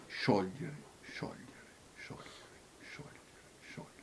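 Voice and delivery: hushed, dwindling echo; fading whisper. Real, not acted. Repeats the word, fading to whisper.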